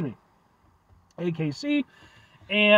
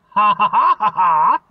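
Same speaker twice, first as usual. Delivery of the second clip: hammed-up laugh; 2 semitones higher, 8 dB louder, slower than usual. Loud exaggerated laugh at drink.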